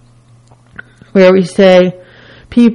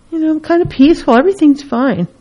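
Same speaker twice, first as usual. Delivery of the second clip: ordinary talk, nothing marked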